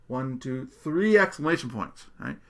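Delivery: ordinary talk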